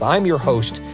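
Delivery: ordinary talk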